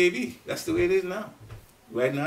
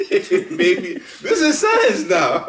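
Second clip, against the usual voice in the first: laughing through the words, higher and louder; 5 semitones higher, 5 dB louder, much slower than usual. Real, not acted. Speaker mocking science's endless maybe, laughing. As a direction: chuckle it out, amused.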